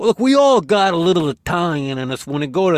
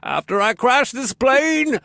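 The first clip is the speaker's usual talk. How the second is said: Bane impression; 7 semitones higher, slower than usual. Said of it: "Bane comes in".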